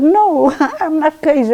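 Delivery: high, loud laughing denial; laughing reply. Retold or imitated. Wife answering her husband, laughing.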